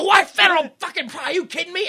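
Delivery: high, loud comic outburst; hammed-up incredulous panic; mock freak-out. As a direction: imitate panicked disbelief, voice way up. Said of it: "they freak out".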